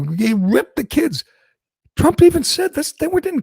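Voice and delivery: whiny mock of Biden's line; mocking Biden quote. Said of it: "Biden thinks if you sit there and go".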